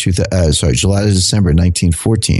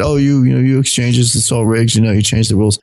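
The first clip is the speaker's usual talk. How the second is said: higher, whiny complaining voice; imitating whining traders, pitched higher; mock whine; 5 semitones higher than usual. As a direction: whine it like a sore loser crying foul. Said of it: "everybody whined".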